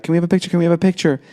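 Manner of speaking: put-on high, eager child voice; imitated pleading kids. Young fans asking a star for a picture, high kiddie plea. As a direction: pipe up like children begging for a photo. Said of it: "maybe ten, twelve years old".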